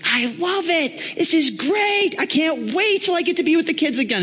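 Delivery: high, squealing mock delight; gushing enthusiasm. Volunteer raving about serving with the kids, gushing. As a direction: gush like a thrilled new convert.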